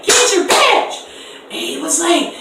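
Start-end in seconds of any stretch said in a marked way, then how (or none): 0.0-0.9 high, loud command; stern demand. Retold or imitated. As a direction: bark the order at the guy. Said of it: "I was like".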